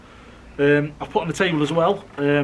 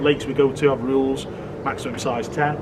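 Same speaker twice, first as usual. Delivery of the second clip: normal talking voice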